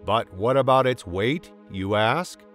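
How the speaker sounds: usual speaking voice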